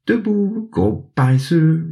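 put-on little girl rousing her sleepy dog, joyful. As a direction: wake the lazy dog up happily. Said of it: "lui dit-elle joyeusement".

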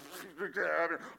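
laughing despite trying to stay serious; a short laugh breaking through; a chuckle you can't hold back; stifled laugh. Retold or imitated. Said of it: "laughed at the wrong time".

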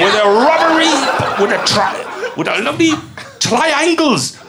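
high mock Japanese accent. Contestant hinting at the place, in a mock Japanese accent. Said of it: "It was Japan".

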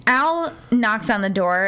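ordinary delivery

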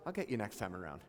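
hushed, low and patient; a quiet, kindly God voice. Acted. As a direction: murmur it like a gentle promise. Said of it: "God just comes back and goes".

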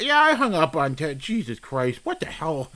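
low, soft aside; exhausted mutter. Real, not acted. Host muttering to listeners after hanging up, drained.

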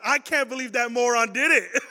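loud line broken by laughter; laughing. Retold or imitated. Girlfriend mocking the tattooed ex, laughing loudly. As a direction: laugh through the words in disbelief. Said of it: "And she's laughing".

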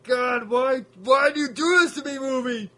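high, loud, hammed-up despair at the movie; mock anguished wail. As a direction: wail it like the film is torturing you. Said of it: "You're like".

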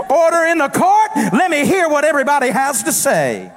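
God calling to order. Acted. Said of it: "God ... convenes the court and says".

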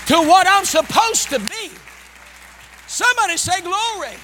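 high, loud exhorting preacher; full-force preaching. Real, not acted. Pastor rebuking self-doubt before the congregation, full-force preaching. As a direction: drive it home high and loud, rallying the room.

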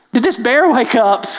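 ordinary delivery

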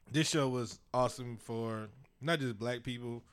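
normal talking voice

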